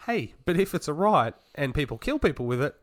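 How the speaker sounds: usual speaking voice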